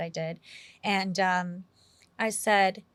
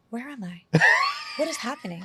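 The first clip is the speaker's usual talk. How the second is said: laughing disbelief; bewildered, half laughing; amazed; 3 semitones higher, 5 dB louder than usual. Retold or imitated. Retold amazement on set.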